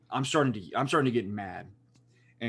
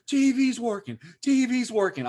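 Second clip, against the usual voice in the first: high, loud relayed shout; calling from downstairs; 10 semitones higher, 4 dB louder than usual. Retold or imitated. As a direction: call it up through the house, imitating someone downstairs. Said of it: "all I hear is the line downstairs go".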